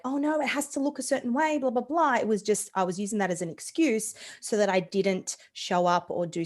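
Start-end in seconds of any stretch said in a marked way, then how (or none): 0.0-2.1 higher, fretting over looks; fussy perfectionist. Retold or imitated. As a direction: fret that it must be perfect. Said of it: "I was like".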